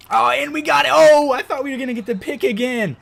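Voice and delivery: high, loud thrilled yell; excited shout. Real, not acted. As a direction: burst out over a near interception. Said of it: "Ah, I got excited".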